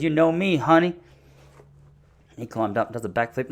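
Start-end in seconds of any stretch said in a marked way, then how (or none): 0.0-1.0 higher, louder imitated American wife; North Dakota wife. Wife answering her husband, raised mock voice. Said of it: "She goes".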